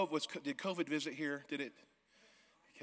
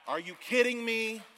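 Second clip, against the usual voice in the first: higher, louder disbelieving repeat; amazed disbelief; 10 semitones higher, 5 dB louder than usual. Real, not acted. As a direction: say it again, even more stunned. Amazed at building.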